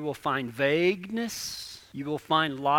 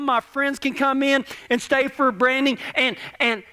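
put-on fast, louder breathless child's wish list; excited kid rambling; 7 semitones higher, 6 dB louder than usual. Boy listing his dreams to the crowd, excited run-on. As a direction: rattle it off like an eager boy. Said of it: "a little kid about dreams".

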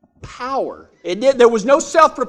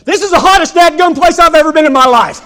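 louder, higher, fast exasperated complaint; exasperated outburst; 7 semitones higher, 8 dB louder, faster than usual. Retold or imitated. Friend complaining to the narrator about the heat, exasperated outburst. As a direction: blurt it out, beaten by the heat. Arthur overwhelmed by heat, exclaiming.

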